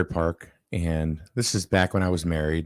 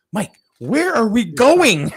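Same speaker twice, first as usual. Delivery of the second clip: put-on high, loud, drawn-out kid's demand; mock bewildered yell; 14 semitones higher, 9 dB louder, much slower than usual. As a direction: imitate a child loudly demanding an answer, slowly. Retold child demands the destination.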